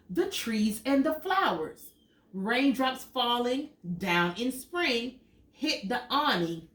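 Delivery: lively storybook read, big high questions; animated picture book reading; reading to children. Acted. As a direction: read it out to little kids with wonder. Reads picture book aloud.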